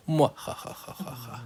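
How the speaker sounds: whispered evil villain cackle; slow breathy mwahaha laugh; mustache twirling villain. Pretend. Mock evil laugh after plan.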